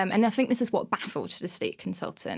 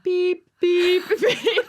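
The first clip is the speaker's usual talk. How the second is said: higher, louder mock beeping; reversing beeps; 10 semitones higher, 7 dB louder, much slower than usual. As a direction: beep like a truck backing up. Imitating reversing caravan.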